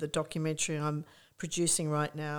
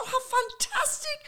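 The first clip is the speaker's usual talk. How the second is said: very high mock squeal; high-pitched, effusive delight; delighted gushing. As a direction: imitate a thrilled friend. Acting out her delighted reply.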